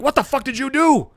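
high, loud mock-angry demand; outraged coworker. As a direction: imitate a man storming in, yelling. Retells IT guy storming in.

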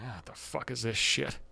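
a private grumble to himself; muttered aside. Retold or imitated. Inner reaction to odd question.

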